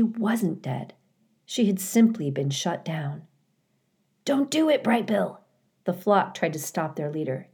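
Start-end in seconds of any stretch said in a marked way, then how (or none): 4.2-5.4 lekko odegrany, wysoki głos gęsi; high, urgent warning without shouting; zaniepokojone stado. A narrator's reading. Flock warns its leader.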